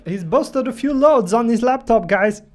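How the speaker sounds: mock official calling out a find, loud; hammed-up shout to colleagues; chamando os colegas aos berros. Mock airport staff announcing hard-drive findings.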